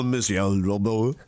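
put-on slow, low French-accented name; mock French accent. Host teasing co-host about her boyfriend's surname, French accent.

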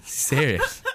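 ordinary delivery

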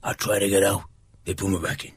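low, soft film-mobster voice; second go at a hushed mafia boss; Al Pacino impression. Co-host retrying Godfather three line, low Al Pacino impression. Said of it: "I'll try again".

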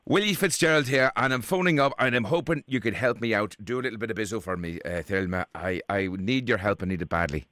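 put-on a prank caller in a lightly done Irish accent; a hint of an Irish accent, asking a favour. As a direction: play Willie Fitzgerald, a chatty Irish fella, with a light Irish lilt. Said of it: "including me being an Irishman".

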